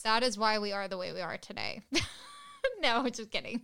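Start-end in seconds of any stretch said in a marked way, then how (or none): 1.9-2.8 sudden high-pitched laugh. Real, not acted. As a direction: burst into a squeaky laugh. Laughing at own joke.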